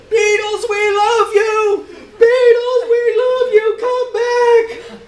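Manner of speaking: very high, loud mock fan scream; hammed-up crowd chant, very high and loud. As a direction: shriek it like a weeping teenage fan. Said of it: "weeping teenage girls screamed the same message".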